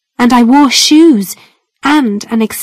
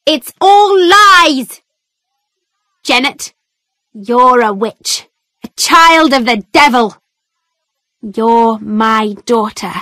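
accusing shout. A narrator's reading. Mother accuses her daughter before the judge, shouting. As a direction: hurl it at her daughter, then drop to certainty. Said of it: "my mother shouted".

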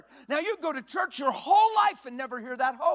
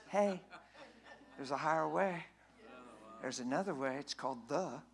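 quiet nudge; 13 semitones lower, 13 dB quieter than usual. Pretend. God's quiet nudge voiced.

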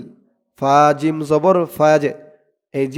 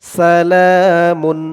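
slow recitation; 4 dB louder, much slower than usual. Shown as a demonstration. Teacher models the word slowly.

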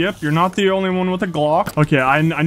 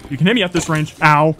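ordinary talk, nothing marked

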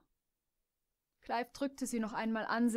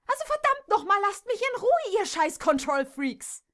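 furious tirade; 10 semitones higher, 7 dB louder than usual. Acted. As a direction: explode at them till you scream. Jess explodes at the mares. (Jess schreit die Stuten immer lauter und höher an, außer sich vor Wut)

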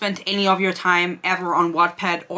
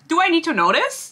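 high-pitched disbelieving question; exasperated question; 8 semitones higher than usual. Real, not acted. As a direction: ask in stunned disbelief, voice jumping up. Exasperated reaction to underwear detail.

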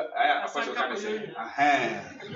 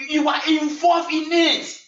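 high, loud, slow declaration; emphatic preaching shout; 10 semitones higher, 7 dB louder, slower than usual. Real, not acted. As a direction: shout it slowly to the congregation. Preacher drives the point home.

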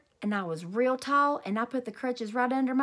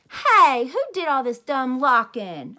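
indignant; 2 semitones higher, 8 dB louder, slower than usual. A narrator's reading. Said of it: "I said".

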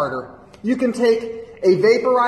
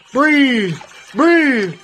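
loud, raised-pitch bellowed order; urgent command; 7 semitones higher, 5 dB louder than usual. Acted. Instructor ordering Ernie to breathe, urgent loud command. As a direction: shout the command in single bursts.

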